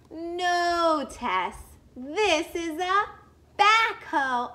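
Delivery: put-on a big kid proudly naming the machine; emphatic correction. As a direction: announce the backhoe with gusto. Character corrects Tess.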